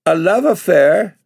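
put-on a girl's shocked indignant cry, love rising high. A narrator's reading. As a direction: fling love affair back, high and outraged. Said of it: "cried Bella with flaming cheeks".

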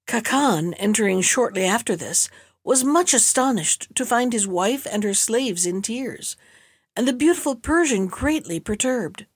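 ordinary delivery

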